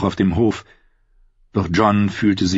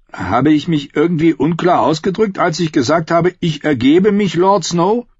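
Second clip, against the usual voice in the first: higher-pitched, good-natured exasperation; protest, pitched up, without real anger; friendly complaint; 9 semitones higher, 3 dB louder than usual. A narrator's reading. Beaten Emmet chiding Lord Snow, friendly complaint. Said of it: "Trotzdem war sein Ton freundlich".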